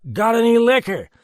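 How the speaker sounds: put-on high, loud drunk, stress on liquor; drunken demand. A narrator's reading. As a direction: press for liquor again.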